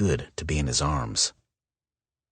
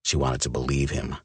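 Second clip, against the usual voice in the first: plain reading voice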